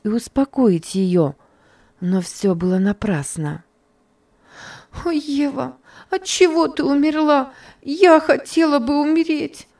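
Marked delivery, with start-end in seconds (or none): 5.0-9.7 put-on much higher, wailing child's voice; Topsy wails over dead Eva in despair; cry of despair. A narrator's reading. High despairing wail. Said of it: "этот крик дикого отчаяния".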